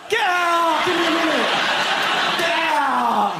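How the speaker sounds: hammed-up panicked shout; mock yell. Caught teenager yelling at nan to get out. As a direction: yell them out of the room.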